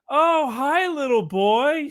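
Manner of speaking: put-on high, slow sweet old lady voice; little old lady. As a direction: coo at the kid like a sweet old granny. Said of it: "this little old lady opens the door".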